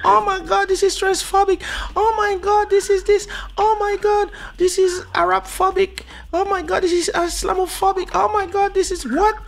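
shrill falsetto outrage, way up high; mock outraged complainer. Mocking the easily offended in a squeaky, high voice. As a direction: squeal it like a scandalized snowflake.

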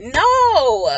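loud, high, drawn-out exclamation; emphatic refusal. Real, not acted. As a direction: cry it out in exasperation. Emphatic refusal capping a frustrated rant.

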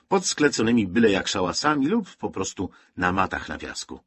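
plain reading voice

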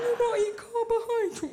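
high, loud mock-condescending voice; smug dieter. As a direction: push it high as a smug dieting woman. Smug dieting woman, acted.

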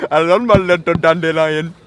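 slow, loud mock Indian accent. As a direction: stretch it out in a hammy Indian accent. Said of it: "doing an Indian accent".

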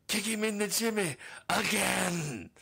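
high, loud, slow mock-cruel order; the universe gleefully ordering punishment; hammed-up cosmic bully. Universe voiced as cruel figure.

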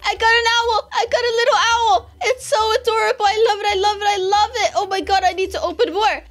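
very high, loud joyful screaming; overjoyed shrieking; ecstatic shriek. Real, not acted. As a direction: scream with joy over the owl. Got the owl.

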